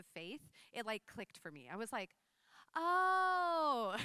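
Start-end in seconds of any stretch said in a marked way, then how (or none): 2.7-4.0 a loud, high "Oh" as it finally clicks; sudden realization. Retold or imitated. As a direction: gasp out the discovery, loud and high.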